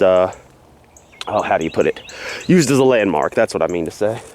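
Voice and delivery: ordinary talk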